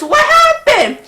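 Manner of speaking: high, startled "what happened?"; confused surprise. Retold or imitated. Rider baffled when the coaster stops, high startled question.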